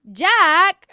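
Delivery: loud, high Boston "Jack"; a failed Boston accent on "Jack". Acted. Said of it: "I can't do a Boston accent".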